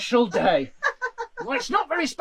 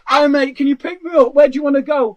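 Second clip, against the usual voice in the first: louder, chirpy hailing a driver; loud mock phone call; 9 dB louder than usual. Customer calling an Uber from the queue, loud and chirpy. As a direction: shout it down the phone.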